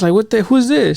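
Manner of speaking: retold disbelief, pitched well up; high, baffled question; startled questioning. Teen asking mom about the stranger, startled. Startled by mom's unknown friend.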